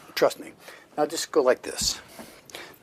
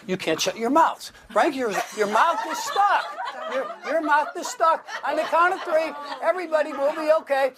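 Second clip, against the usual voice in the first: much higher, louder stage patter; excited showman build; 12 semitones higher, 3 dB louder than usual. Real, not acted. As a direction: whip the crowd up toward the big count. Stage hypnotist building to release.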